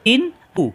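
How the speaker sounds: ইন উ said high as a hamza, no throat pressure; teacher showing the wrong ইন উ; wrong hamza reading. Shown as a demonstration. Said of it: "এভাবে যদি পড়ি তাহলে হামজা হয়ে যাবে".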